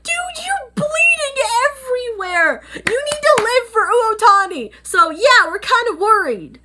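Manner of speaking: high, loud tearful plea; distressed high pleading. Retold or imitated. Vlogger begging the bleeding Kurono to live, high and tearful. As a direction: beg him to hold on, voice cracking.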